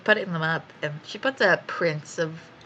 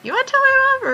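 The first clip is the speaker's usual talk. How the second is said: much higher and louder, exasperated; incredulous ask; 9 dB louder than usual. Retold or imitated. Asking her best friend about her mom, incredulous. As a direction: throw it at her in disbelief.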